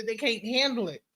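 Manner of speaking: ordinary delivery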